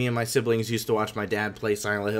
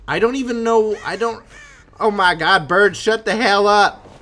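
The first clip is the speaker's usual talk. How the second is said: raised, high-pitched exclamation; rattled outburst; 7 semitones higher, 7 dB louder than usual. Real, not acted. Player telling a bird to shut up, rattled and loud. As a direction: burst out, getting louder at the noisy bird.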